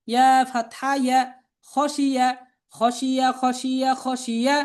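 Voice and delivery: level, measured chant with drawn-out letter names; spelling drill recitation. Shown as a demonstration. Teacher spelling out Arabic words for learners to repeat, chanted drill.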